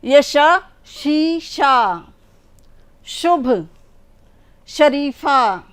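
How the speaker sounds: शीशा drawn out slowly with a clear palatal sh; each श word spelled out syllable by syllable; शीशा, शुभ, शरीफा read slowly with the sh sound stressed; careful palatal sh. Shown as a demonstration. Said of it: "श का उच्चारण करते हैं हम".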